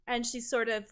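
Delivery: normal talking voice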